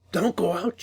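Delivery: high, urgent cry. A narrator's reading. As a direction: call out to stop him. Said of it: "she cried".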